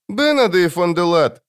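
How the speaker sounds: put-on high, sorrowful child voice; child demon sadly addressing someone by name; Жемчуг, sad. A narrator's reading. Demon calls the wizard by name, sad child voice. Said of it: "грустно проговорил Жемчуг".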